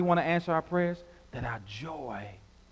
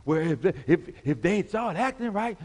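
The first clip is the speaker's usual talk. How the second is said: mimicking excuse-makers; 5 semitones higher than usual. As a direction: imitate someone making excuses about others. Mimics complaining folks in relationships.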